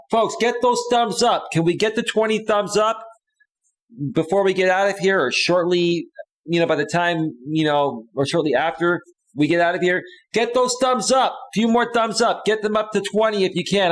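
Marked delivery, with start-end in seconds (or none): 0.1-6.1 high-pitched rallying appeal; eager call for support; rallying viewers. Real, not acted. 8.1-13.9 high, insistent rallying call; eager pitched-up plea to the audience; rallying viewers. Real, not acted.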